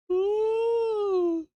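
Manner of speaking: squeaky anguished ooh; high pained cry. Real, not acted. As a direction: cry out in dismay.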